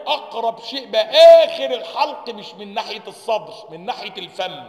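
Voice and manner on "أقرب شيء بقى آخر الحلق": emphatic. Real, not acted. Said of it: "teacher stressing point".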